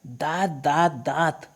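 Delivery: mildly acted exasperation; a touch of irritation, not shouted; irritated. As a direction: repeat it like a vexed husband. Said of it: "चिडलेला आहे आणि म्हणतो आहे".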